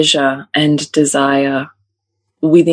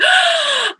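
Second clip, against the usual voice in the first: sharp high gasp; excited gasp. Retold or imitated. Mother imitating daughter before announcing, high gasp. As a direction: gasp like a thrilled child. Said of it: "she's like".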